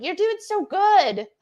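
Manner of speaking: put-on a touch of babying coach; coaching ferments. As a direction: coax the jars along gently. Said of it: "I coach them along".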